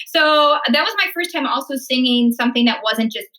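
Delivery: normal talking voice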